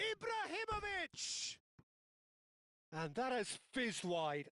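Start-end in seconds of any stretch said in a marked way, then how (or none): 0.0-1.6 high, slow stretched name; excited name call. Real, not acted. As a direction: sing out the name as he shoots. Shot by Ibrahimovic called.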